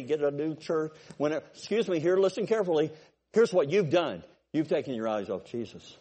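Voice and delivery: normal talking voice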